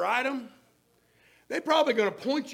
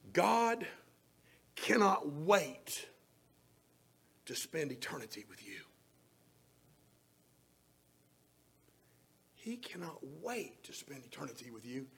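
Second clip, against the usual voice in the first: whisper; 6 semitones lower, 7 dB quieter than usual. Acted. Preacher whispering God's longing to the listener, soft and intimate. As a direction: whisper it close and tender. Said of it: "whispered intimate line".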